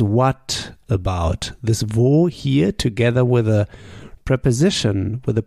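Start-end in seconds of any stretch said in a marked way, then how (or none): 0.0-1.5 slow gloss. Shown as a demonstration. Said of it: "But the meaning is".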